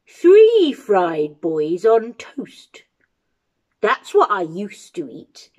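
put-on relishing old giant, then reassuring; old toothless giant. Old giant greets a boy at his castle door, relishing.

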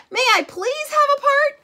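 eager plea. Acted. Trapezoid begs for a part.

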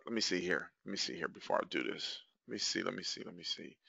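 very soft, low self-talk; muttering to himself. Real, not acted. Host checks his setup before starting, muttering to himself. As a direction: think aloud under your breath. Talking to himself while setting up.

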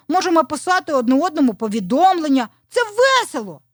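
high, lively rooster explaining proudly; high-voiced cheerful young character; eager little rooster.

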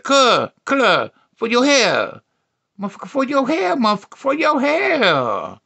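high, loud, dragged-out ending; exasperated woman imitation. Woman explains curlers are for hair, high exasperation.

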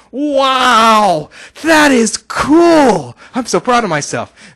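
loud, high, stretched-out amazement; big high wow, easing as it goes on; real excited. Real, not acted. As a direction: cheer it out, proud and thrilled. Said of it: "Sorry, I got real excited there".

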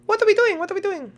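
very high squeaky question; high-pitched game character. Acted. Character confused about the last round, squeaky. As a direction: ask in a tiny squeaky voice.

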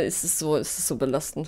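quiet fearful groan; mock dread. Real, not acted. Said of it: "ich hab so Angst".

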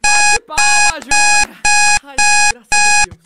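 high, loud relieved shout. Real, not acted. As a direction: shout it out, finally free. Relieved the video is over.

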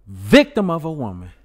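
hammed-up host climax. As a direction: land it like a TV host. Host's dramatic finish.

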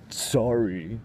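put-on sulky childish sorry, rising; little kid. Grumpy old man apologizing like a sulky kid. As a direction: say it like a pouty child. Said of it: "Like a little kid".

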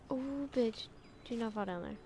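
ordinary delivery

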